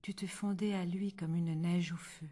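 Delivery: ordinary delivery, nothing marked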